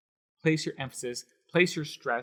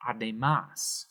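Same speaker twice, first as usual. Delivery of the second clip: slow "Además", weight on the closing MÁS; stress on final syllable; 4 semitones lower, slower than usual. Shown as a demonstration. Teacher repeating the correct word, slow Además stressed on MÁS. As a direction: stretch Además and land on MÁS. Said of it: "we put an accent right here".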